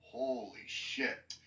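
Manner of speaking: slow, low and soft awe; retold stunned reaction. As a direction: stretch it out, quietly stunned. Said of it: "Even the Suicide Squad were like".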